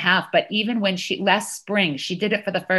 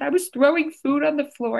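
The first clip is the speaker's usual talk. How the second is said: lightly voiced toddler, pitched up; small child confessing; 7 semitones higher than usual. Daughter owning up to mother, lightly imitated high child voice. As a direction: confess like a little kid, in a higher voice. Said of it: "And she said".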